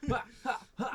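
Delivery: real burst of laughter; high, loud laughing. Real, not acted. DM laughs at the failed save.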